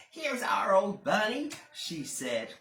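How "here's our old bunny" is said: high, louder brisk nanny voice; a busy nanny, brisk. Acted. Nanny fusses.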